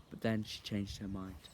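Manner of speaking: ordinary delivery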